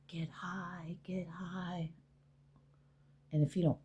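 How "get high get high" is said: hushed, coaxing whisper; soft, insistent whisper, repeated; tempting whisper. Retold or imitated. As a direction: whisper it like a devil on the shoulder. Ego tempting her to relapse.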